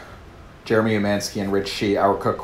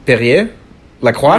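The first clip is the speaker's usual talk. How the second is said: French-accented brand names, offered as questions; higher, louder French accent with a questioning lift; 5 semitones higher, 12 dB louder than usual. Shown as a demonstration. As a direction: try it in a French accent, unsure, voice rising. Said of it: "Say it with the French accent".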